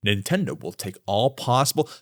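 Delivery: low, soft, stiff official imitation; mock corporate statement. Host doubting the president's exact quote, mock-formal low voice. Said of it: "Do you think he said this exact quote".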